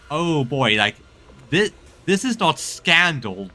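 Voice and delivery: ordinary delivery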